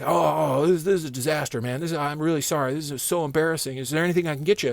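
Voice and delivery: mock sorry boss; apologetic boss. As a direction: play the boss embarrassed.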